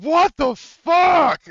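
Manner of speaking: loud high panicked yell, drawn out; shocked yell. Retold or imitated. GM recalling the player's panicked outburst, a loud shocked yell. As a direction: blurt it out in total panic. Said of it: "after you were like".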